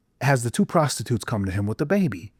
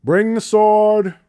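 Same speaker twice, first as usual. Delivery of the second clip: slow, louder, higher royal command; King Solomon demands the sword, slow and commanding; 9 semitones higher, 5 dB louder, slower than usual. Retold or imitated. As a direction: order it slowly like a king. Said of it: "He goes".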